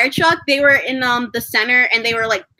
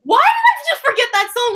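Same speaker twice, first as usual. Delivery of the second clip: shocked at myself; 12 semitones higher, 4 dB louder than usual. Retold or imitated. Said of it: "I'm like".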